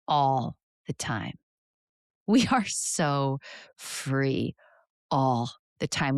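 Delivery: slow, spacious delivery with long pauses; unhurried breathing-exercise voice; guided meditation. Real, not acted. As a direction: guide them gently inward, phrase by phrase.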